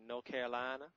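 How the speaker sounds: mock Southern accent with the r dropped in "North"; Southern drawl. Host on growing up in North Carolina, mock Southern drawl. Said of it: "a beautiful Southern drawl".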